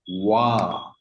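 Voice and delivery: wa said with a low, open w sound; wa pronunciation. Shown as a demonstration. Teacher showing how pa reads as wa. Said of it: "like this".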